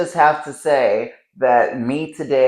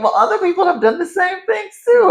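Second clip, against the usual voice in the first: lightly voiced inner reply, much higher and louder; a mild, raised answer back to her inner critic; higher self-talk; 7 dB louder than usual. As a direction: pitch it way up as a hopeful inner voice. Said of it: "But then I'm like".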